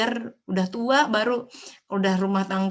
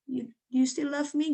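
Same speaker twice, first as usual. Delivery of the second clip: lightly voiced small child, a touch higher; little daughter asking; 5 semitones higher than usual. Mother imitating daughter Elia asking if she is still loved, lightly childlike. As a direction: gently mimic the child's worried question. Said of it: "dia akan bilang".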